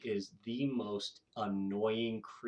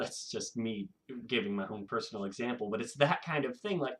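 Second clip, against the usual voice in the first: ordinary talk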